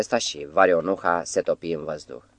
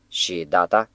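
usual speaking voice

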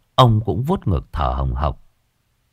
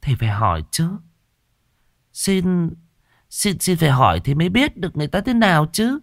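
higher, quick coaxing wife's voice; 9 semitones higher than usual. A narrator's reading. Wife insists they should ask the girl's family, coaxing. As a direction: nudge him, quick and light. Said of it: "Nghe vợ mình thủ thỉ".

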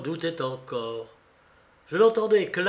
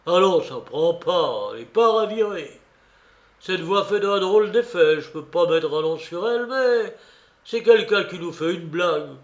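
louder, higher-pitched rallying Silver; Silver rallying his men; 3 semitones higher, 6 dB louder than usual. Acted. Silver to frightened pirates about a mystery voice, loud bravado. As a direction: voice Silver talking his crew's fear away.

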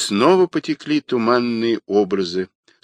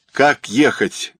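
ordinary delivery, nothing marked

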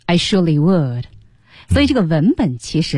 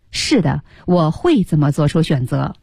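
ordinary delivery

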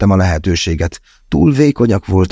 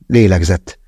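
usual speaking voice

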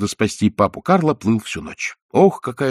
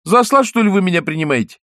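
put-on indignant boy; 7 semitones higher than usual. A narrator's reading. Pinocchio offended.

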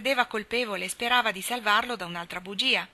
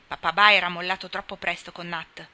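ordinary delivery

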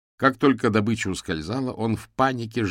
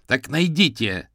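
loud, higher, slow bark; barked retort; 6 semitones higher, 7 dB louder, slower than usual. A narrator's reading. Юрген answers Бернард's complaint, barking loudly. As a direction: bark it back at him. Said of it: "рявкнул Юрген".

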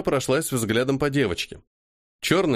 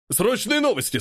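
high, breathless urgent call; urgent announcement; 11 semitones higher than usual. Acted. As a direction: burst in shouting news. Man bursts in with news.

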